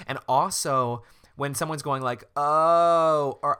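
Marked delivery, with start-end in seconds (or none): 2.4-3.4 long, loud "oh" wail; mock scream. Host showing how characters scream in the book, mock scream. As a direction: imitate a drawn-out scream, held out loud. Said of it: "like, screaming".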